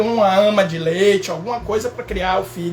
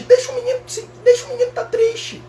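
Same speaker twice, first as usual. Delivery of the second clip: high whiny mock parent voice; mock doting parent; 14 semitones higher than usual. Imitating parents indulging a sad boy, high whine. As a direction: whimper it like a coddling parent. Mocking parents who coddle kids.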